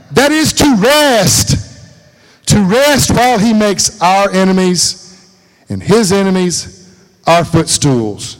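slow, loud preaching of scripture; stretching each word from the pulpit. Acted.

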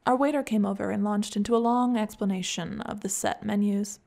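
plain reading voice

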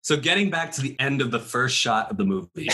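ordinary delivery

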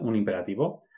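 ordinary talk

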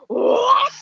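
high-pitched mock outrage; hammed-up freak-out, pitch shooting up. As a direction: imitate an appalled, rising shriek of disbelief. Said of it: "and she freaked out".

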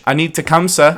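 lightly put-on Scottish accent. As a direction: try the line as a Scotsman. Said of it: "A Scottish".

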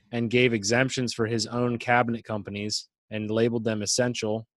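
ordinary delivery

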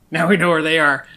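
loud sarcastic thanks. Acted. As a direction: sneer out the thanks. Mocking thanks in character.

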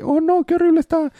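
high squeaky mock panic; hammed-up shriek of dismay; mock horror. Heroes flee in mock horror.

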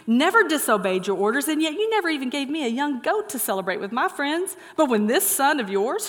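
indignant complaint, climbing high at the end; angry older brother. Acted. Older brother protesting to his father, acted indignation. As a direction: act out an aggrieved son's outburst. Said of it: "The older brother became angry".

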